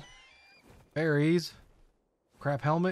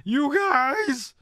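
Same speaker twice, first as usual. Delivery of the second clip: so excited; 9 semitones higher, much slower than usual. Real, not acted. Streamer tells chat she's thrilled, laughing squeal. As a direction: giggle then squeal to the viewers. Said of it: "I'm so excited".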